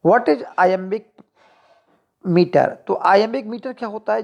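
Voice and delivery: ordinary talk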